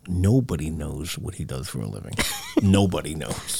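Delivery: low, soft, confidential aside; hushed secrecy. Pretend. Host on guest's secret job, mock-hushed. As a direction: drop right down as if sharing a secret.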